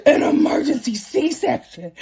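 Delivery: huge high gasps around slow, drawn-out words; mock horrified gasping. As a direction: gasp and repeat it in hammed-up shock. Retelling shock at the doctor's news.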